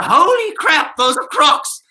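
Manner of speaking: loud, high, drawn-out outburst of discovery; shocked realisation. Acted. Comedian finally spotting someone's disguised Crocs, shocked realisation. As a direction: exclaim it in mock horror.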